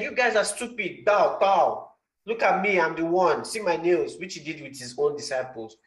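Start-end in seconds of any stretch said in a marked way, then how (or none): 0.0-4.2 higher, mocking show-off voice; mock boastful Jesus. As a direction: lord it over them in jest. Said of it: "He could have just said".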